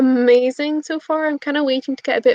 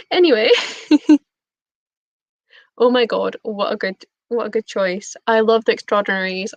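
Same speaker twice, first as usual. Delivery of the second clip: normal talking voice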